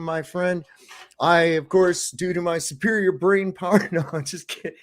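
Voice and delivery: usual speaking voice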